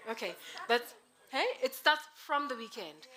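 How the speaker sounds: usual speaking voice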